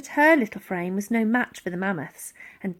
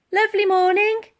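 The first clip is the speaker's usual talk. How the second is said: high, louder cheery remark; breezy greeting; 11 semitones higher, 6 dB louder than usual. A narrator's reading. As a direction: greet the stunned man casually as you slide past. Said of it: "remarked Elsie as they slid by".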